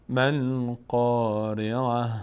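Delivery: ما القارعة chanted slowly, stress on ما; recited Quran phrase. Shown as a demonstration. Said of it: "Quran recitation demo". (تلاوت آهسته با تأکید بر ما)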